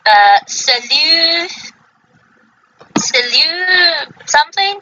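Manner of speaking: "Salu" drawn out slowly, trailing into a guess; halting pronunciation attempt. Real, not acted. Reader not sure how to pronounce this tribe, halting attempt. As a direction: stretch "Salu" slowly, unsure of the ending. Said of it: "I'm not sure how to pronounce this tribe".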